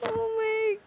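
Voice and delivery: high, whiny disbelief; startled cry. Real, not acted. Gamer still stunned by the floating character, high whine. Continued shock.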